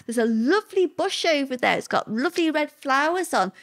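a hint of the husband's feigned innocence; husband's innocent excuse. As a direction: play the innocent admirer. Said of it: "He said".

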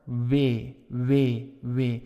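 W said like the w in water; Flemish W. Shown as a demonstration. Teacher demos the Flemish W to students. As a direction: round the lips for W. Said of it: "pronounced more like in water".

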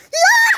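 very high, loud shriek; mother yelps as the dog lunges at her; startled shriek. Real, not acted. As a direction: yelp in sudden fright.

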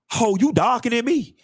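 sassy call-out. Acted. Mock telling off a friend.

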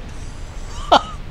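squeaky high laugh; delighted squeak of a laugh; high giggle. Real, not acted. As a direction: burst into a giddy high giggle. Laughing after lava discovery.

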